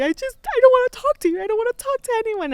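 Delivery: high, loud, crying whine of frustration; imitating sobbing. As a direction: wail it like you're crying mid-sentence. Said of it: "I was just crying because I was so frustrated".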